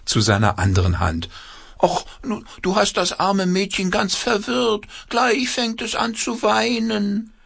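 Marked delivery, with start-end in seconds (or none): 1.8-7.3 very high squeaky little puppet. A narrator's reading. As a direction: pipe it like a tiny hand puppet. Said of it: "mit piepsiger Stimme".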